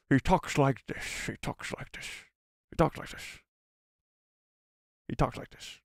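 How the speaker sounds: hushed, slushy Scottish film-star impression; Sean Connery. Shown as a demonstration. Streamer prompting chat to guess the actor, soft slurred impression. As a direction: slur the s into sh, softly. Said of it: "Sean Connery, yes, Sean Connery".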